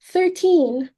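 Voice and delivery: horrified hostess repeating the count; shocked cry. Acted. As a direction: recoil at the number. Hostess shocked at thirteen guests.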